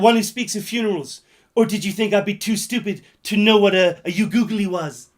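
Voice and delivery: loud hammed-up quote; shouted film quote. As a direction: belt the quote out in full ham. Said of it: "It goes like this".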